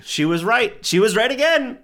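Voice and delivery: building to a loud high cry; realizing excitedly. Retold or imitated. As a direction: exclaim in growing realization. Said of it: "Paul and I go".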